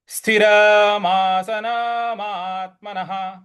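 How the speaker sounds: स्थिरमासनमात्मनः ending in a clear nah; visarga as ha. Shown as a demonstration. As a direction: sound the visarga as ह at the end. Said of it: "विसर्ग ... ह का उच्चारण ले आएंगे".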